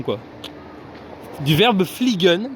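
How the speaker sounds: ordinary talk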